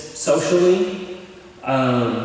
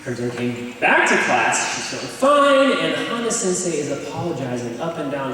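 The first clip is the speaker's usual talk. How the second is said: normal talking voice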